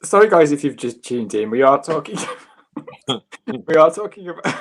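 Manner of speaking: fighting back giggles; laughing. Real, not acted. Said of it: "you're making me laugh".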